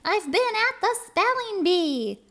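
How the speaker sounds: a tiny high critter denying it; squirrel voice. Acted. Squirrel protesting innocence to Pete, squeaky high voice. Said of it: "Squirrel said".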